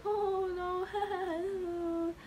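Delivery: high, cooing mock consolation; put-on nurse soothing a crying patient; imitating a nurse. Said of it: "all I hear is the nurse like".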